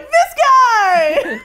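high, loud delighted shout. Retold or imitated. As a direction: yell it in delight at a friend. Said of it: "and then you're like".

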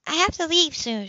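a light lift for the kind social worker; lightly voiced woman. A narrator's reading. Anna voiced by narrator.

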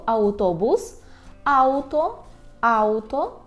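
автобус and ауто with "au", each set apart; Polish au. Shown as a demonstration. Teacher models words for learners, автобус and ауто with clear au. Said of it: "що вимовляємо ау".

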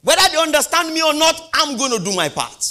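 high, loud, defiant vow; fervent shout. Acted. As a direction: declare it whatever comes. Preacher peaks.

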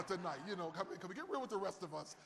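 ordinary delivery

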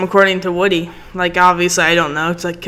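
ordinary delivery

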